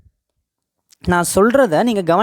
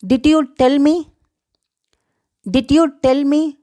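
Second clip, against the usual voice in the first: slow, higher-pitched careful question; slow model phrase; 6 semitones higher, much slower than usual. Shown as a demonstration. Teacher has learners say it along, slow and careful. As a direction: say it slowly for learners to copy.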